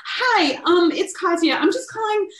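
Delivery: high, bright-faced phone hello; a pregnant woman calling her agent, faking cheer; mock chirpy phone greeting. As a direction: act casual and cheerful on the phone. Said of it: "I was just like".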